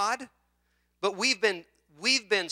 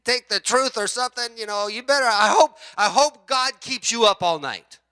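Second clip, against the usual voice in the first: retold preacher's jab, a bit higher and louder; imitated preacher; 6 semitones higher, 5 dB louder than usual. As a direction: deliver the warning as the other preacher. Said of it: "the guy that was speaking said".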